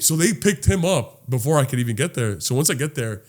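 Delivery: usual speaking voice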